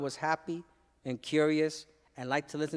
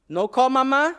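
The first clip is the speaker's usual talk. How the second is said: put-on high, loud pleading kid; hopeful child's question; 6 semitones higher, 6 dB louder than usual. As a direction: ask like a worried little boy.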